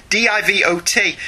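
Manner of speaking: "D I V O T" letter by letter; spelled out. Shown as a demonstration. Host clarifies the word, spelling "D I V O T" aloud. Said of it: "when I call you a divot".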